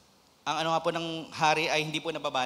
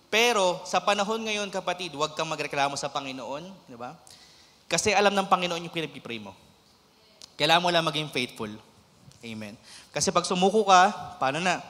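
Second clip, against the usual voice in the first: rising, louder preacher's appeal; fervent preaching; 2 semitones higher, 5 dB louder than usual. Acted. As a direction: drive the point home to the congregation. Preaching at full force.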